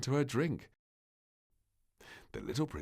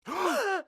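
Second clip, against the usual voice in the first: startled gasp; 7 dB louder than usual. Acted. King and queen wake.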